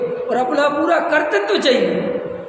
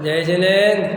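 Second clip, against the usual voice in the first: lower, half-laughing jai jinendra; offhand greeting; 5 semitones lower than usual. Shown as a demonstration. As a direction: toss the greeting off dismissively. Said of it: "किस tone में बोली गई".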